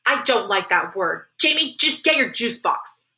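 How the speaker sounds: slow, firm motherly reprimand; stern. Acted. Mom sends Jamie off for juice, stern. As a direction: lay down the law, word by word.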